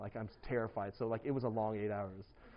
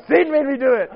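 loud, high-pitched joking excuse; mock excuse; 21 dB louder than usual. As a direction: plead it comically, high and loud. Jokey excuse acted out to congregation.

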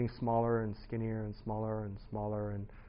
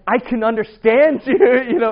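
laughing; 12 semitones higher, 17 dB louder than usual. Retold or imitated. Caught out, laughing retort.

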